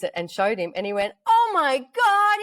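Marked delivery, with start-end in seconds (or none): 1.3-2.4 very high, drawn-out, louder mock amazement; over-the-top excited. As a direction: imitate a partner hamming up delight. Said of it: "can you just get real excited for me".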